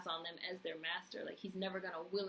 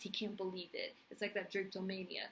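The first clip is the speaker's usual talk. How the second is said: normal talking voice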